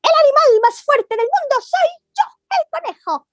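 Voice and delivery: high, proud rabbit boast; bunny bragging to the elephant; boastful little rabbit. Acted. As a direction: crow it out like a tiny champion.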